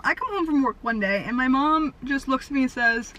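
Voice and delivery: normal talking voice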